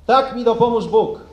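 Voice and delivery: solemn, measured oath dictation; formal oath reading. Real, not acted. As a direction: read each oath line out for the ranks. Officer reading the oath line.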